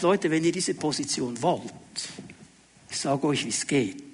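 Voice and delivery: soft, low, confiding teacher; quiet instruction. Acted. Jesus voiced instructing disciples. (leise, tiefe Unterweisung)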